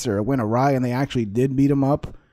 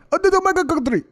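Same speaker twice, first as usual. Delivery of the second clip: very high-pitched mock Nigerian accent. Shown as a demonstration. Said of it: "that's Nigerian right there".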